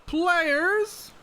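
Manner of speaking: high, loud summoning call. Real, not acted. Game master summoning the players, calling out.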